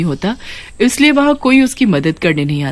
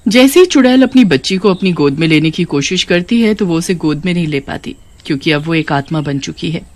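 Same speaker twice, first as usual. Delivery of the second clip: ordinary delivery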